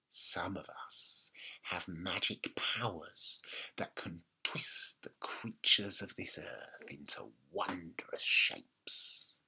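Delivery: low, slow throaty witch's whisper; grandma whispering to frightened George. A narrator's reading. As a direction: whisper it like a spell. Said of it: "whispering in a throaty sort of voice".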